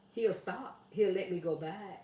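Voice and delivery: ordinary talk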